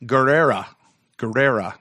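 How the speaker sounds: "Guerrera" with plain English R's; unrolled R's. Real, not acted. Host attempts a listener's surname, unrolled R's in "Guerrera". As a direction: say "Guerrera" without rolling the R's. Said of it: "I don't roll my R's".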